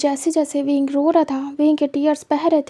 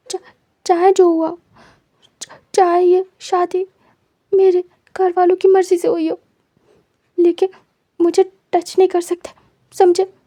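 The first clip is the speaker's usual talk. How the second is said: scared stammering warning; 3 semitones higher than usual. Acted. Wing, frightened, warns Wang Ji to stay away, stammering. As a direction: stutter out a frightened warning to keep him away. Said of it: "wing डरा हुआ था।".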